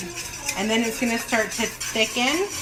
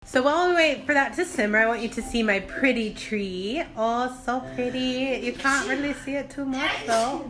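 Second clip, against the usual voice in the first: ordinary talk, nothing marked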